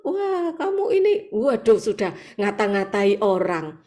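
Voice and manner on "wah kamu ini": high shrill scolding, fast; mock angry scolding. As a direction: scold someone in a pitched rant. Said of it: "Marah-marah". (omelan marah dengan suara tinggi)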